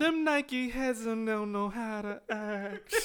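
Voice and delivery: slow, high, fake wailing; mock crying wail. Mock wail about Nike heads.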